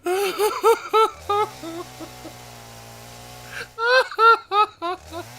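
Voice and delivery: slow, high, wheezing with laughter; laughing. Real, not acted. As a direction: stretch it out while laughing. Can't stop laughing.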